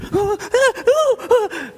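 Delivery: high, hemming mock ahs; mocking lukewarm wavering, neither hot nor cold; mock hesitant ahs. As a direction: waffle like someone lukewarm. Lukewarm hesitation in scripture quote.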